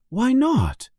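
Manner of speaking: put-on a boy asking, eager to know; young Harry. A narrator's reading. Harry voiced high.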